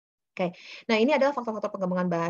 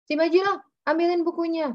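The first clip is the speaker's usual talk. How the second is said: put-on higher, louder call to a child; orang tua menyuruh dari jauh; 9 semitones higher, 5 dB louder than usual. Shown as a demonstration. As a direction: call out an order across the room. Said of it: "biasanya orang tua cuma kayak gini". (orang tua memanggil anak dengan suara tinggi dan lantang)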